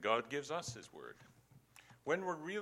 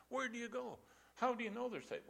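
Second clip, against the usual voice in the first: put-on high questioning young man; a lightly voiced skeptical young man; young man asking; 9 semitones higher than usual. Voicing young man's question.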